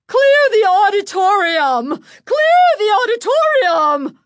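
high, full-force alarmed shout. A narrator's reading. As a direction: shout to evacuate a whole hall. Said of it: "Missus Berman was shouting".

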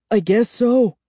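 high, mildly choked reply; a touch of choked-up nerves. A narrator's reading. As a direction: lightly force the words out, pitched high. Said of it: "I choked out".

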